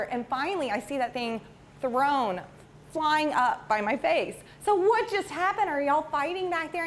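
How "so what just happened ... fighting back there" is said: high-pitched retold demand to know; mock-alarmed parent checking on the kids; alarmed questioning. Driver calls to squabbling boys in the back seat, alarmed retelling.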